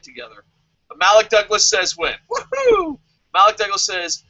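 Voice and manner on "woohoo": high whooping cheer; excited cheer. Real, not acted. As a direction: shout a joyful woohoo. Cheering a good comment.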